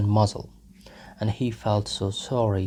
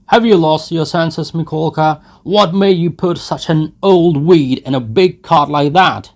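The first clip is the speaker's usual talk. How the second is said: loud, higher jeering; mocking crowd shouts; 7 semitones higher, 12 dB louder than usual. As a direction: jeer loudly at the old nag.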